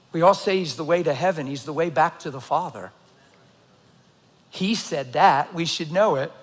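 ordinary talk, nothing marked

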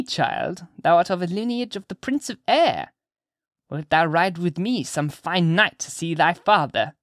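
put-on high, slow old woman's voice; old crone confiding a secret, drawn out; beckoning old witch-lady. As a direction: coax the child as a sly old witch. Mistress Hibbins tempts the child.

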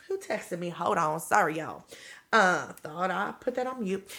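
hushed distracted aside; quiet aside. Real, not acted. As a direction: mumble it off-mic, sheepish. Phone interrupts recording.

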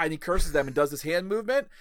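ordinary talk, nothing marked

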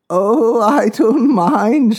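put-on breathy then slow, high old lady; old woman voice. A narrator's reading. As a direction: wave it off gently, elderly lady.

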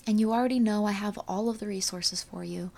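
normal talking voice

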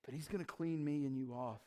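quiet, hushed reassurance; soft intimate preaching. Real, not acted. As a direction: draw them close, gently.

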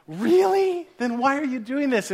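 high-pitched mock disbelief; incredulous questioning. Believer answering God, incredulous protest.

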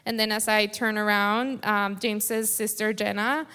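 ordinary delivery

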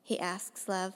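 plain reading voice

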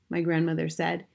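ordinary delivery

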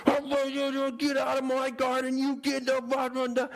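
mock yell. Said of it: "he'd yell".

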